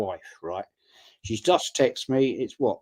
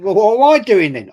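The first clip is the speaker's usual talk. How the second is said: louder, higher, mock-indignant; retelling the row with his daughter, raised and indignant; retold raised voice; 8 semitones higher, 11 dB louder, faster than usual. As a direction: hammer the point home, raised. Retold argument, loud peak.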